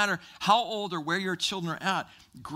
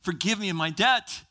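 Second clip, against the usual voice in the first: higher-pitched acted pleading; begging; 4 semitones higher, slower than usual. Preacher plays the servant begging his master, pleading. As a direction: plead for mercy, voice lifted. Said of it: "he begs and he pleads".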